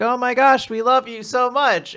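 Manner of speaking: high-pitched imitation of adoring children; retold squeal of affection; gushing kids. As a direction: gush like a crowd of little fans. Adoring children gather round Lulu.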